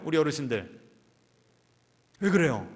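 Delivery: ordinary talk, nothing marked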